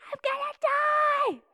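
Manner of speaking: high, slow shouted wail; mock dying shout. Retelling labor pain, she yells she'll die. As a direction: wail like she's about to die. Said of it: "And she's like".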